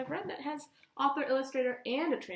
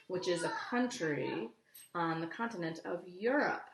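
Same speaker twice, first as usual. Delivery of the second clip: ordinary delivery, nothing marked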